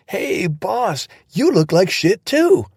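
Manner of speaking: bursting with glee; excited. Acted. Riley's excited greeting.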